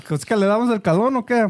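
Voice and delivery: teasing. Retold or imitated. Teasing the cable guy.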